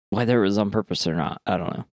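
ordinary delivery

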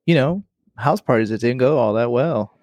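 ordinary talk

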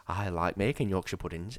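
low, soft, brooding delivery; Thomas advert voice. Acted. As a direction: start the advert quietly, holding back the rage. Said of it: "They get Thomas in and say".

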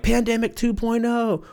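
high, bright product-launch voice; mock announcer. Comedian mocking a product launch, high announcer voice. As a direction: announce it like a new gadget.

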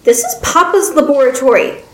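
put-on a stubborn little girl objecting; girlish, put-out protest; upset young girl. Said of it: "shook her head stubbornly and looked upset".

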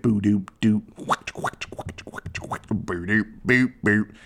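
goofy mouth noises, whispered whacks in the middle; mock fight noises. Recapping Gordon's kangaroo court, playful mouth sound effects. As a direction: burble the nonsense, then hiss the whacks.

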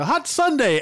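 high chirpy cheer of a team chant; mock cheer. Retelling the band's huddle cheer, chirpy and high. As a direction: pitch it up like a peppy huddle. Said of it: "They go".

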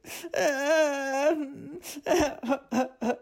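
high whimpering sob; girl's sob. A narrator's reading. Serena weeping by the empty basket, high sob. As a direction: cry like a frightened child. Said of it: "Serena cried".

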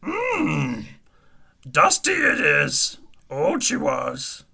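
Yoda impression, slow inverted speech; slow wise little alien master. Yoda eats placenta.

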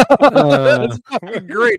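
loud, high laughing delight; real laughing. Real, not acted. Co-host reacting to squint-your-eyes meme, laughing hard. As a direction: burst out laughing through the words.

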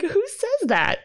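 can't believe anyone wrote that; incredulous. Real, not acted. Laughing disbelief at movie line.

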